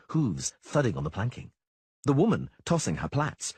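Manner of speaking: ordinary delivery, nothing marked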